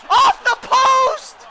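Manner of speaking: very high, drawn-out yell; explosive high-pitched shout; huge shout. Real, not acted. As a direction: scream it out slowly in disbelief. Shot hits the post.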